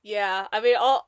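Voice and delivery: normal talking voice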